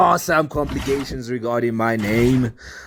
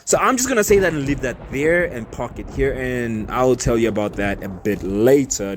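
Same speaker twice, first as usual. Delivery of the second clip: ordinary delivery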